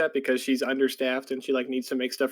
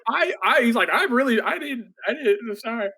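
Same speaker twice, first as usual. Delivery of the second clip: high, stammering, tripping over the apology; flustered and panicky, can't get it out; a lightly voiced nervous boy; 7 semitones higher than usual.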